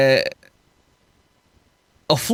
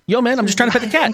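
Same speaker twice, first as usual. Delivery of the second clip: quick, higher-pitched plea to the officer; protesting arrested man; 5 semitones higher, faster than usual. Acted. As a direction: protest your innocence in a hurried, lightly put-on voice.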